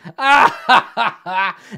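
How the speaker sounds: loud, high goblin guffaw; villainous goblin laugh. Acted. Goblin boss laughs at his grisly trophy, gloating laughter. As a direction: burst into a big gloating laugh. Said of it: "And he starts laughing".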